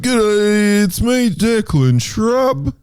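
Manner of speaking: Declan impression. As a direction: drawl it out like an Aussie Declan. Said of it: "Can I do my Declan impression?".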